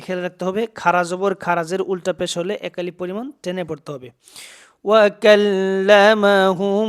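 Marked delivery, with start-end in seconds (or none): 4.9-6.9 slow, drawn-out chanted Quran recitation, held long on each vowel; teacher chants the verse slowly for the students, in tajweed recitation style. Shown as a demonstration.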